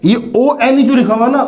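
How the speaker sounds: normal talking voice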